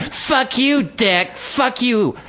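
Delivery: high-pitched mock screech; mimicked parting shot. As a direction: fling insults in a put-on high voice. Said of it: "like a little parting shot".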